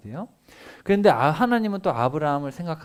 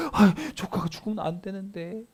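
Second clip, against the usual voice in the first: higher, anxious fretful voice; worried fretting; 7 semitones higher than usual. Acted. Abraham worrying his nephew in Sodom will die, anxious and high. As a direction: fret over the nephew in worry.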